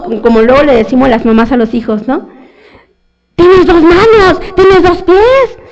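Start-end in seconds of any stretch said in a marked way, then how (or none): 3.4-5.6 scolding mother. Retold or imitated. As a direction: drill it into the child like a stern mom. Mothers scolding their children.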